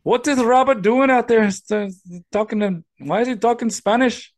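high-pitched, baffled parent voice; mock puzzled parent. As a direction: question it in a high put-on voice. Co-host joins as the parent.

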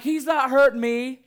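high, loud joking protest. Retold or imitated. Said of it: "I'm like".

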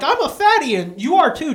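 high, loud mocking voice; mocking mom. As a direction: taunt in a high put-on voice.

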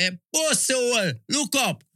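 high-pitched mock call across a stone fight. Boy calls to rivals during a stone war, calling out.

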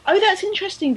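normal talking voice

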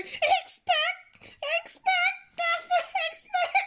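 very high ghostly wail breaking into laughter; laughing ghost voice. Retold or imitated. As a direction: try the ghost line but keep cracking up. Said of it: "So I just kept going".

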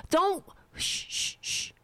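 high shh fading to a whisper; mock shushing. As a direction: shush yourself like an establishment hall monitor. Mock establishment shushing him.

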